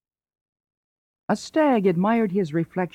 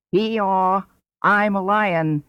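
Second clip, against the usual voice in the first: braying donkey; 3 semitones higher, slower than usual. A narrator's reading. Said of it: "the donkey brayed".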